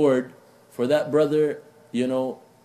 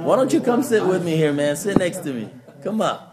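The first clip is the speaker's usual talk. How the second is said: calling out; 5 semitones higher, 4 dB louder than usual. Real, not acted. Calling a youth to sit near.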